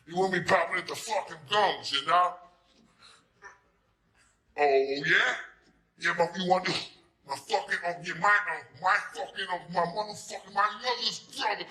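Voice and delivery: high, loud Staten Island tough-guy talk; Staten Island gangster. Retold or imitated.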